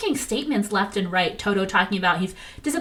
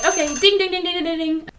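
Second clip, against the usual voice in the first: high sing-song bell dings, much higher; imitated bell ding; 9 semitones higher than usual. Vlogger running out of time, dinging a mock timer bell. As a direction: ring it out like a quiz bell.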